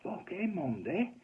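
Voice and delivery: high-pitched, drawn-out outraged question; flipped landlady. Retold or imitated. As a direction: imitate an incredulous, fuming pub landlady. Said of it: "And she just flipped".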